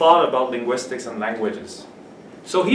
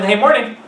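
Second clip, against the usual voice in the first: breezy, higher "morning"; native casual greeting; 6 semitones higher than usual. Shown as a demonstration. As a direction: toss out "morning" as you walk in. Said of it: "If I walk in".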